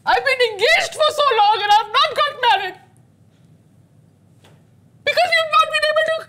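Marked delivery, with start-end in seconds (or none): 5.0-6.2 fighting back real tears; crying. Real, not acted. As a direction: voice breaking into tears, a little higher. Upset wife-to-be breaks down crying.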